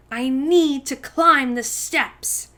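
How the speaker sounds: firm insistence. Acted. Girl insisting to grown-ups she must climb, slow and firm. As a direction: insist on every word, slowly.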